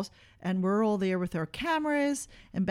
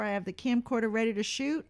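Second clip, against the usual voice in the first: normal talking voice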